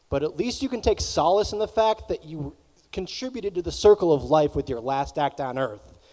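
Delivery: ordinary talk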